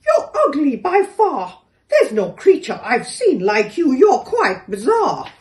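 scornful kangaroo. A narrator's reading. Kangaroo insults the door.

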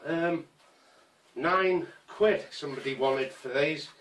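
normal talking voice

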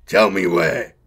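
loud orc roar, dropping low on the demand; angry cut-off. Acted. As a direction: silence the snivelling grot. War boss cuts off grot.

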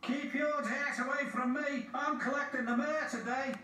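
high-pitched haughty engine voice; James the engine. A narrator's reading. James keeping dirt away before collecting the mayor, haughty. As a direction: pitch it high, warning others off.

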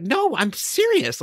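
high, drawn-out indignant retort; getting madder. Retold or imitated. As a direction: act out mounting exasperation. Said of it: "getting madder and madder".

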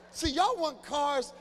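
high, loud and very slow; slowed-down pointed jab; slow emphatic call-out. Real, not acted. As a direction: drag each word out to the crowd. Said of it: "slow emphatic roast".

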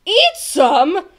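high, loud shocked question; gasping. A narrator's reading. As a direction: repeat it in horrified disbelief. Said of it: "gasped Bartholomew".

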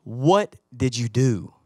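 low, slow, stern delivery of "what did you do?"; weighty question. Shown as a demonstration. Father asking son the big question, stern. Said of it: "this is a big one".

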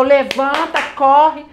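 reenacted viewer's frantic shout; urgent yelling. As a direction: shout her back onto her feet.

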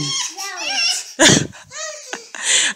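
long, high giggle; amused high squeal of laughter; high-pitched laugh. Real, not acted. Amused by child's pronunciation.

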